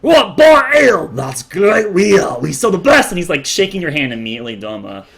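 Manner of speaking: high, loud salesman dwarf; eager dwarf father. Acted. Dwarf father pitching ale to customers, loud and high. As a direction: sell it hard.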